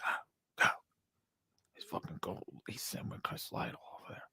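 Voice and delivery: barely audible, breath-only whisper; hushed, starstruck whisper in retelling; imitated whisper. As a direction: whisper it secretly to your friend. Retold hushed alert to friend.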